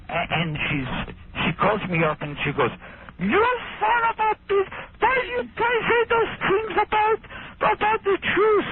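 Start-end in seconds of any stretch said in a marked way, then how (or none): 3.2-8.7 very high-pitched shrieking impression of a housekeeper; mock an irate housekeeper in a piercing falsetto; screaming German housekeeper. Comedian imitates housekeeper's late-night phone rant, shrieking falsetto. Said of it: "screaming at me about the Holocaust".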